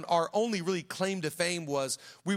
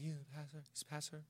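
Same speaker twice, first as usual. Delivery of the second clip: very soft, low mumbled admission; embarrassed; 3 semitones lower, 19 dB quieter, faster than usual. Retold or imitated. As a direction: re-enact a kid barely admitting it, almost inaudible. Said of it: "I was embarrassed to say what my dad did".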